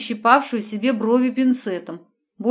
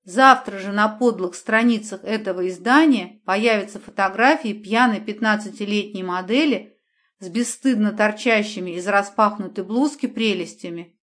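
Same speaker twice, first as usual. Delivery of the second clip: usual speaking voice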